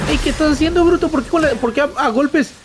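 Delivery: high-pitched startled scolding; real high, agitated outburst; alarmed protest. Real, not acted. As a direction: cry out in indignant disbelief at the game. Shouts at game character under attack.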